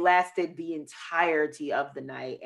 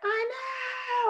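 far higher, shouted from a distance; mom screaming back. Retold or imitated. Mom on the balcony yelling back at her newly engaged daughter. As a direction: yell it from a balcony. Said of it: "she screams back".

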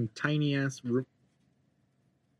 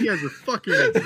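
laughing retort; 8 semitones higher than usual. Retold or imitated. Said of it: "I was like".

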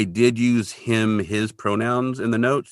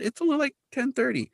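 very high, softer protest; mock defensive; 5 dB quieter than usual. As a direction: imitate an innocent excuse in a high voice. Kolchak defends being late.

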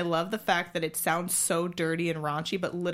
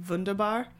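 wunderbar with a German v, vunderbar; German pronunciation; 2 semitones higher than usual. Retold or imitated. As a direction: say wunderbar the German way, vun-der-bar. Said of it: "he said".

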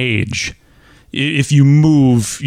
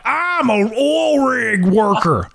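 slow Oklahoma drawl, "oil" said like "all"; an Oklahoma rig worker correcting you, drawled "oil"; offended Oklahoma accent; 7 semitones higher, slower than usual. Retold or imitated. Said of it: "they'd be like".

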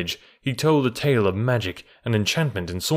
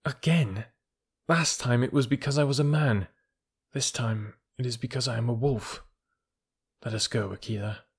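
hurt resignation; 5 dB quieter than usual. A narrator's reading. Mowgli rejected by the village.

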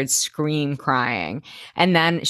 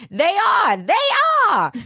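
hammed-up squawk, pitched way up; Babette. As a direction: call it out in a shrieky character voice. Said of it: "we hear Babette say".

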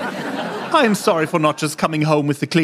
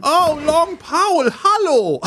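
delighted greeting; 10 semitones higher, much slower than usual. Acted. Delighted greeting returning neighbor.

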